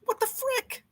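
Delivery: squeaky high outraged squeal; very high thin squeak; mock disbelief. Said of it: "I'm like".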